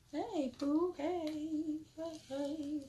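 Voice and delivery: high, soft hello; affectionate greeting. Real, not acted. Host greets Pooh coming in, high affectionate greeting. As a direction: greet them sweetly, high and softly.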